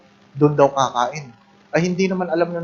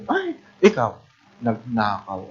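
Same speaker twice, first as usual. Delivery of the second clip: mock shock; 4 semitones lower, 5 dB quieter than usual. Startled at a sinner.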